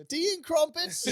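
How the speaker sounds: very high, loud hammed-up British voice; mock posh British. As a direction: squeal it like a caricature Brit.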